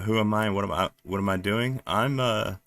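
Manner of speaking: normal talking voice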